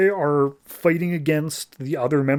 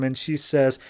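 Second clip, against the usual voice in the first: ordinary delivery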